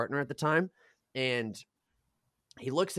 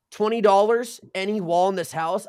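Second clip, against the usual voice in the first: loud, high imitation of a dare; cocky wager, mimicked loudly; bold challenge; 6 semitones higher, 6 dB louder than usual. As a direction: pitch the bet loudly. Stranger's bold bet acted out.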